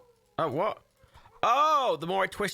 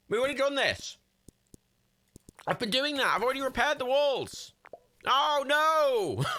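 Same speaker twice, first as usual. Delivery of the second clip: high, whining complaint; exasperated protest; 9 semitones higher than usual. Real, not acted. Gamer objects that walls are already repaired, exasperated whine. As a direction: protest high, building into a wail of dismay. Protesting game's repeated task, dismay.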